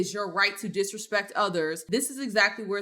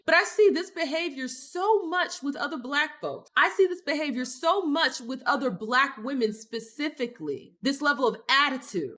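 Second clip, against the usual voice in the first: normal talking voice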